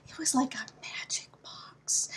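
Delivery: hushed, awed whisper. Real, not acted. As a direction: whisper it like a secret treasure. Confiding about Anne's console.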